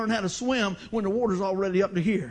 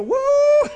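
a high, loud laughing whoop; joyful woo; 15 dB louder than usual. Retold or imitated. Pastor showing how he reacts when trouble comes, whooping. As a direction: let out a big happy whoop. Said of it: "I go".

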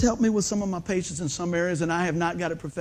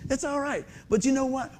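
ordinary talk, nothing marked

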